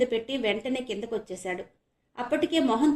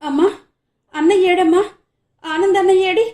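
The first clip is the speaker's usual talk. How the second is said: anxious; 9 semitones higher, 12 dB louder than usual. Acted. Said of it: "ఆతృతగా అడిగింది మాధవి".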